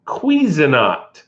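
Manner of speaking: The Cuisinart, slowly as kwiz-uh-NART; mock proper pronunciation. Shown as a demonstration. Vlogger practising the proper brand name, slowly. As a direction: draw out Cuisinart like the clip. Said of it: "Let's see here".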